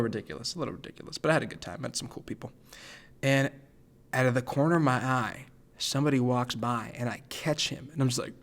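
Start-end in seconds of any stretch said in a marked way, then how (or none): none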